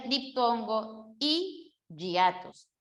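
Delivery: slow "y hiatos", ee held apart, then a y consonant in "hiatos"; yeísmo. Shown as a demonstration. Teacher gives the answer, slow ee before ye sound in "y hiatos". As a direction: separate "y" and say "hiatos" as yah-tos. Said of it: "otra vez el yeísmo".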